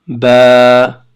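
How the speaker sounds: ba held long and loud; long vowel ba. Shown as a demonstration. Teacher demonstrating a long vowel to students, drawn out. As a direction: stretch the vowel in ba. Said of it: "on va prolonger".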